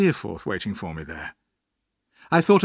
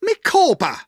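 very high, loud exclamation; high-pitched dramatic outcry; wife's cry; 7 dB louder than usual. Acted. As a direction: cry out his name in shocked protest. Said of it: "cried his wife".

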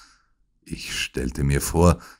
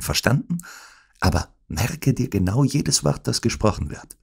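urgent, higher-pitched orders; commanding tone; 6 semitones higher than usual. A narrator's reading. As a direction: order your friend into hiding. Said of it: "seinen im Befehlston gesprochenen Worten". (im Befehlston)

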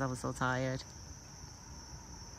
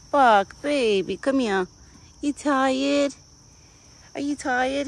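pet talk; 8 semitones higher, 6 dB louder, much slower than usual. Real, not acted. Cooing at her yawning deer.

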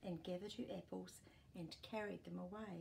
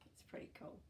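whisper; 6 dB quieter, much faster than usual. Real, not acted. Aside to the child.

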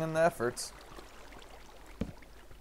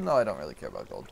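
ordinary talk

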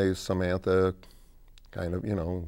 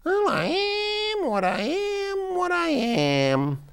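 very high, drawn-out creature voice; eerie high-pitched singsong; Bakito; 4 dB louder, slower than usual. Acted. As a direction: chant it slowly as a strange night creature. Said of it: "they hear somebody saying".

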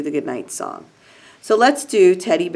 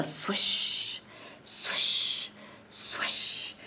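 breathy whispered sound word; reading a rustling sound to kids as a whisper; whispered swish; 11 dB quieter, much slower than usual. Acted. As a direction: hush the swish like wind in reeds.

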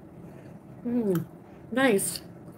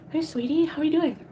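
sweet greeting; 8 semitones higher than usual. Real, not acted. Greeting a viewer.

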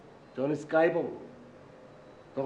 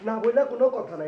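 higher and louder insistence; emphatic; 6 semitones higher, 3 dB louder than usual. Acted. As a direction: drive the rule home. Director repeating his rule more forcefully.

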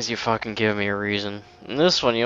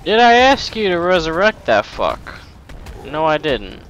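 loud, high-pitched indignant scolding; mock outrage; 6 semitones higher, 7 dB louder than usual. Gamer scolds an enemy for resurrecting another, in mock outrage.

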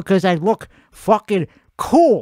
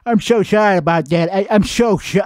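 ordinary talk, nothing marked

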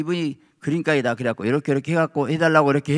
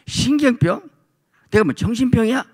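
offended disbelief; 10 semitones higher than usual. Retold or imitated. Offended retort to husband.

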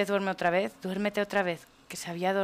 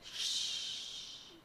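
long, soft shush; 14 dB quieter than usual. Pretend. Player hushes the crying baby, softly. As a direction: hush a baby very quietly.

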